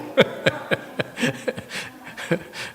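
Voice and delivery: breathy amused laugh, falling away; a soft cackle trailing off; high airy laugh. Real, not acted. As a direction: laugh at your own joke. Laughs at own joke.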